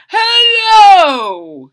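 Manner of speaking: loud, high greeting; booming king hello. Acted. King greeting the giraffe at his door, booming loud. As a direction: call out a big hello.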